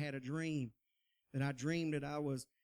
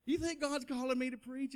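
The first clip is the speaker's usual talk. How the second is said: higher, earnest asking; eager question; 8 semitones higher than usual. Retold or imitated. Young man eagerly repeats question to preacher friend. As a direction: ask it up and out, hopeful. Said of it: "You know, I am".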